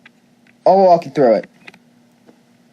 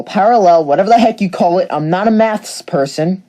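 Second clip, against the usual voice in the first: usual speaking voice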